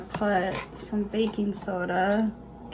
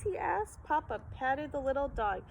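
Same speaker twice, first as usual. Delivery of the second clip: ordinary delivery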